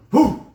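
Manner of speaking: sudden high yelp of alarm; a loud high cry as he leaps out; high startled whoop. Retold or imitated. Jumping out of a burning car, a high alarmed whoop.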